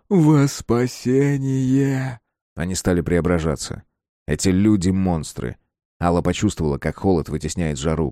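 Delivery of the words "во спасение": high, very drawn-out chant; long-held congregational call; alien crowd voice. A narrator's reading. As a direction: intone it like a zealot at prayer. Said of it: "чужих и настоящих".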